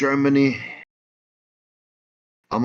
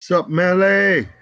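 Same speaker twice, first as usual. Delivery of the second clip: loud slow greeting; 7 semitones higher, 5 dB louder, slower than usual. Real, not acted. Calling out a greeting.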